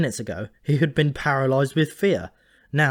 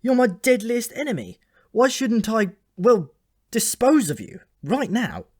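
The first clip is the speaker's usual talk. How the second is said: high, loud menacing challenge; a boy's raised voice trying to sound dangerous; menacing threat; 11 semitones higher, 5 dB louder than usual. Acted. Malcolm threatens Alberic.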